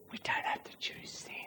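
very soft, hushed aside. Real, not acted. Preacher to congregation on their freedom, hushed. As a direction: drop to a near-whisper.

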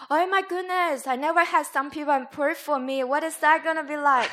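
high, loud burst of amazed excitement; excited girl. Retold or imitated. Girl reacting to chaplain's offer of prayer, bubbling with excitement. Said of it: "she's all excited to jump up and down".

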